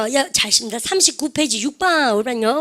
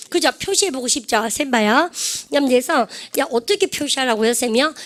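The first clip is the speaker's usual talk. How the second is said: ordinary delivery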